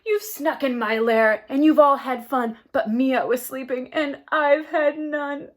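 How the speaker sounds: loud, crying complaint; wailing bear. Acted. Bear woken from sleep complaining to the guests, crying. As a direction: wail it through tears. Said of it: "he whimpers and he moans".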